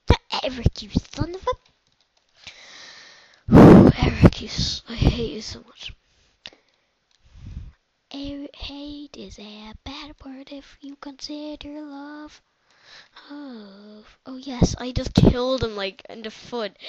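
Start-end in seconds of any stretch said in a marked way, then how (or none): 3.5-4.0 loud sigh. Real, not acted. 4.9-12.4 whisper. Acted. Whispered grudge then musing.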